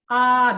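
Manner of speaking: long A held high and level; A as a high, steady Mandarin first tone. Shown as a demonstration. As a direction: sustain A loudly on a high, even pitch. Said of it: "Ah, itu nada satu".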